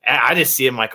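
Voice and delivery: ordinary delivery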